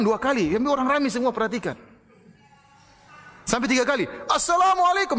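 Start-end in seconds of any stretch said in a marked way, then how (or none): 4.3-5.2 loud salam shout. Retold or imitated. Preacher retells the policeman greeting an idol, shouting. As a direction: bellow the greeting at the statue to provoke. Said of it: "dengan suara yang keras".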